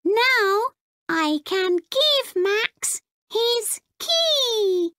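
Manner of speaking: chirpy tiny robot, proud of itself; small robot voice. Acted. Robot Clunk.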